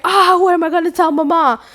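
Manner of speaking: high-pitched mock wail; freaking out. Brother dreading telling Mama, high mock panic. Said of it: "Everybody is freaking out".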